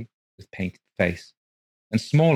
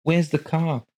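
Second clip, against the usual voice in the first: wife; 9 semitones higher than usual. A narrator's reading. Wife voiced.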